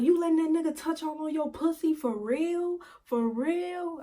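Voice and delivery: high, disbelieving questioning; incredulous accusation. Retold or imitated. Boyfriend accusing her over another man, incredulous.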